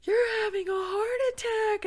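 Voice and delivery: high, loud, slow tearful cry; panicked cry. Retold or imitated. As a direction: cry it out in alarm. Realizing husband's heart attack.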